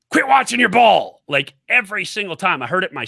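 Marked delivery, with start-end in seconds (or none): 0.1-1.2 coach's repeated order. Retold or imitated. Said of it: "every single time I threw the ball".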